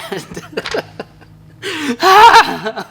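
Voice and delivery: loud laugh. Real, not acted. Laughing after stumbling over Badalassi. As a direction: burst of high loud laughter. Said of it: "laughing at own try".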